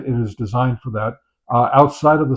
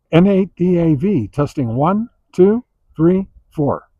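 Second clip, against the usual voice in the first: radio test call; 5 semitones higher than usual. Real, not acted. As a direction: call it out like keying up the mic.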